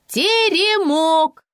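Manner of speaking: high, loud, drawn-out cry; high stretched yell of delight; gleeful shout. A narrator's reading. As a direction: shout the answer out in jubilation. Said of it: "закричала я".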